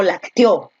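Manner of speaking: lacteó stressed on the final O; stress on last syllable. Shown as a demonstration. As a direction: land hard on the O of lacteó. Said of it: "No dices eso".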